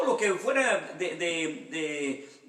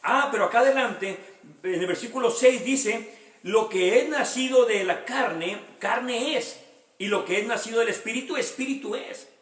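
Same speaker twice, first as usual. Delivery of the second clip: higher and louder, pressing the congregation; fervent preaching; 5 semitones higher, 3 dB louder than usual. Acted. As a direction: preach it out with fire, calling for rebirth. Preaching at full force. (predicación fervorosa, más aguda y fuerte)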